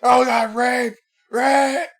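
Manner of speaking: high mock shriek of a fake victim; mocking cop watcher. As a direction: ham up a fake panic cry. Streamer mocks cop watcher yelling.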